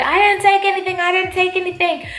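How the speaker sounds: high, loud, put-on protesting voice; the caught thief insisting innocence; denying thief. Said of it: "And then he's like".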